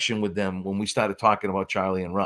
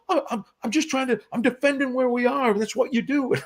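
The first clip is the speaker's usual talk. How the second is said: high flustered excuse; 12 semitones higher than usual. Retold or imitated. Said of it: "He goes".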